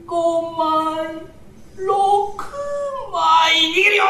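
high eerie drawn-out count; ghostly plate counting. Acted. Ghost counting plates at the well, eerie and high.

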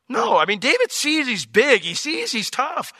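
normal talking voice

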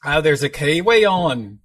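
slow, loud Kiwi accent attempt. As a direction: put on a New Zealand accent. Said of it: "doing a terrible job".

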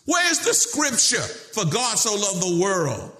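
high, ringing rhetorical demand; impassioned high-pitched pulpit challenge; preaching at full force. Real, not acted. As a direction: preach it out, pitch soaring on the questions.

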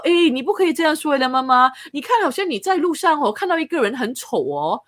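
mother imitating her daughter's earnest lecture, high and loud; lecturing daughter. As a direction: put on the little girl setting mom straight. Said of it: "然后她就说".

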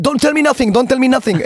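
high-pitched, worked-up plea; excited on stage. Retold or imitated. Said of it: "I get excited on stage".

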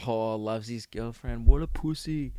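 low, soft, slow mock jeer; mock teasing. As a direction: tease a mate under your breath. Mock teasing after girlfriend's call.

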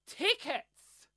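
ordinary delivery

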